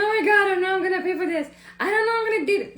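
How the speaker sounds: imitated panic. Imitating her own panic about paying bills, fast and loud. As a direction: blurt the money worry in a rush. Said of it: "I'm like".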